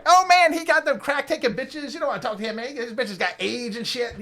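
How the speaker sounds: squeaky street hustler, high up. Retold or imitated. Little hustler badmouthing the rival pimp. Little guy voiced pitching.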